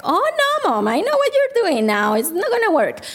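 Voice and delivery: long high "oh no", lightly mocking a wised-up kid; son seeing through it. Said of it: "So he's like".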